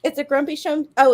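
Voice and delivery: ordinary delivery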